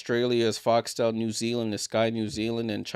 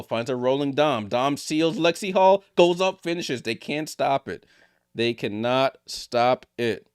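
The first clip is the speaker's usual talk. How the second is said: rising, louder live basketball call; play-by-play call; 3 dB louder than usual. Acted. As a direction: call the drive and finish as it happens.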